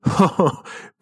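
laughing off the son's alarm; father's laugh. Retold or imitated. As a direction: burst into a laugh. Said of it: "زد زیر خنده".

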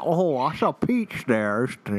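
mock Georgia small talk; Georgia accent. Shown as a demonstration. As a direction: play at a Southern Georgian. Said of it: "I can kinda do a Georgia".

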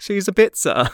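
high, laughing punchline; amused. Real, not acted. Host repeating the dog-breed joke, high and laughing.

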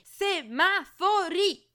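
very high, loud and slow shriek; shouted correction. A narrator's reading. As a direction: scream the word back at him. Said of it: "urlò lei di rimando".

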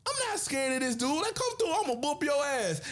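hammed-up fearless challenge; mock bravado. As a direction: puff up and dare him to come. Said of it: "you like".

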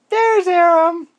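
very high, loud squeaky reveal; excited high reveal. Acted. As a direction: burst out with the surprise. Peekaboo reveal.

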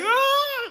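very high squealed scream; mock high-pitched freak-out shriek. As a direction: imitate a little girl shrieking on a ride. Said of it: "Like, freaking out".

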